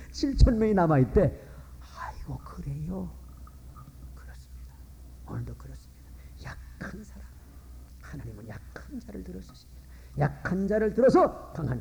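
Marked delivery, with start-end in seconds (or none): 2.0-3.1 surprised whisper. Acted. 4.2-9.8 hushed preaching. Real, not acted. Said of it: "sermon point whispered".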